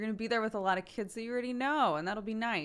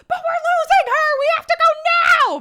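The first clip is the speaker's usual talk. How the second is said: very high, loud mock yell; hammed-up panicked kid shout; frantic little kid; 18 dB louder than usual. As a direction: wail urgently like a child being held up. Imitates child urgent to follow friend.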